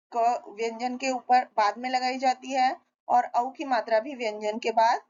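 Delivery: usual speaking voice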